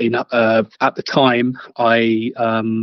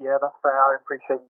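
bad connection; 3 semitones higher, 4 dB quieter, faster than usual. Real, not acted. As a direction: voice cutting out. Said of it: "your voice is cut out again".

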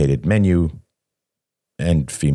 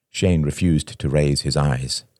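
plain reading voice

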